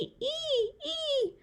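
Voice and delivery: put-on high, thin baby screech; toddler screeching. A narrator's reading. Baby sister joining the chaos: high thin screeches. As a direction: squeal like an excited toddler. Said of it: "she screeched as loud as she could".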